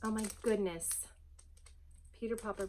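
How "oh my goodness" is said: ordinary delivery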